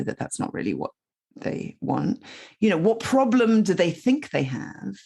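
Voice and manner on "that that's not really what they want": whispered aside. Real, not acted.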